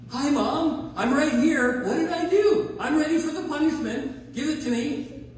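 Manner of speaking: high, chirpy mock child voice; eager little kid. Kid answering mom, mock eager high child voice. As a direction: play the impossibly eager child, hammed up.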